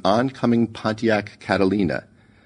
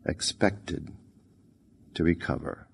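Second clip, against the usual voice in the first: quiet echo; 3 semitones lower, 10 dB quieter than usual. A narrator's reading. Murmured echo of the news words.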